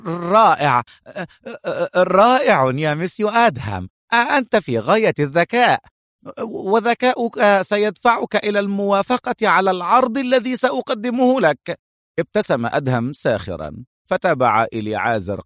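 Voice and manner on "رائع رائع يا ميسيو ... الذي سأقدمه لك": high-pitched stammering nervous flattery; agitated. A narrator's reading. Eliezer flatters the armed Adham before making an offer, agitated. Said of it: "وقال باضطراب".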